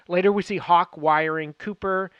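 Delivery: normal talking voice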